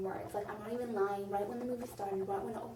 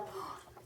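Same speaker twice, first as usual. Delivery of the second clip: mock gasp; 9 dB quieter than usual. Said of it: "book readers are like".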